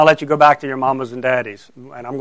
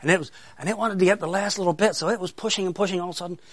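quick, higher and hushed, fading before the bang; building suspense; 3 semitones higher, 7 dB quieter, faster than usual. Real, not acted. Mouse pushes for the last bit, building suspense. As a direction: speed up softly toward the surprise.

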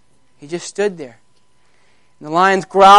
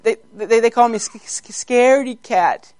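stammering, higher cub voice; timid baby lion; 4 semitones higher than usual. Acted. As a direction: stutter shyly as a scared cub. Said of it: "here was a little baby lion".

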